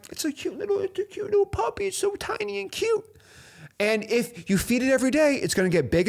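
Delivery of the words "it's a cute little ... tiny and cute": put-on much higher, softer baby-talk voice; high, soft gushing baby talk; cutesy puppy talk. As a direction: coo over a tiny puppy. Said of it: "I'm like".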